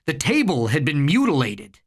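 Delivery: plain reading voice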